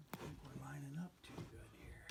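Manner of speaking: hushed whisper to himself. Real, not acted. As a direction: whisper it under your breath. Aside while lining pieces up.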